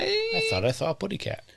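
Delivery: high cartoon bird voice; squeaky little cartoon bird; Tweety Bird voice. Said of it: "Wait, that's Tweety".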